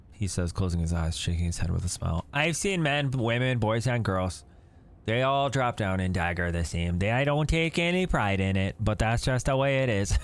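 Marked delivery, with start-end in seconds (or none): 2.3-10.2 lightly voiced Canadian old man; smiling folksy Canadian; Canadian accent. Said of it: "very Canadian to me".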